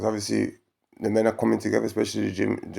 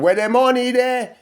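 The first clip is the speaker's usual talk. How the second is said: indignant demand; 12 semitones higher, 5 dB louder than usual. Real, not acted. Rhetorical demand, high and loud.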